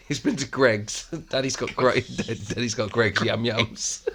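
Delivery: giggling through the words, slow; can barely get it out for laughing. Real, not acted. Joking about Greg's yum yums.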